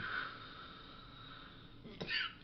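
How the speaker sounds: hard smoker's cough; real cough after a big hit; coughing fit. Real, not acted.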